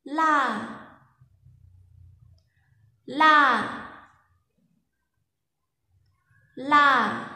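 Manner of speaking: "La" high, level and strong; Lao mai ek tone. Shown as a demonstration. Teacher models the tone for students to repeat. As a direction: say "La" high and flat, loud. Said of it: "các bạn phát âm theo cô nhá".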